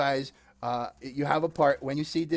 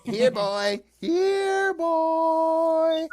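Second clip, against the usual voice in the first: calling a lost pet; 13 semitones higher, 4 dB louder, much slower than usual. Acted. Calling puppy puppet.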